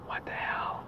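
whispered aside to himself. Real, not acted. Muttering at Uber about low pay, whispered. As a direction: breathe it out with no voice. Repeats the complaint under his breath.

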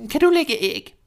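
high, bossy hen voice; hen's voice. A narrator's reading. Hen questioning the duckling, high and bossy. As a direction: play the hen as a shrill busybody. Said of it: "spurgte hun".

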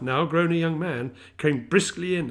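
ordinary delivery, nothing marked

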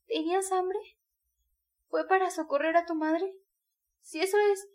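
grasping at an excuse for him, urgently; exclaiming. A narrator's reading. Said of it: "exclamó". (Sonia exclama, ansiosa)

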